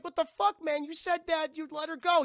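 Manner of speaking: high, loud mock indignation; outraged protest. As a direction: yell it like a betrayed hero. [name] acted protesting broken promise.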